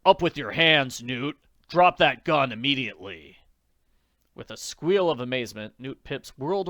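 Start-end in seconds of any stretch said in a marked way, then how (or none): 0.0-3.4 loud, stern holdup command. Acted. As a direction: order him at gunpoint, firm. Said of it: "heard a stern voice say".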